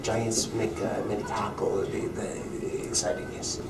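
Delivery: put-on broken English in a thick Russian accent; lightly comic Russian accent. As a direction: play a Russian goalie talking football. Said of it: "this Russian accent".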